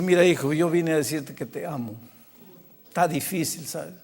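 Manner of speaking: low, soft fatherly confession; tender sincerity. Retold or imitated. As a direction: speak quietly and lovingly, as to a son. Pastor confiesa su amor al muchacho. (con ternura, bajo y suave)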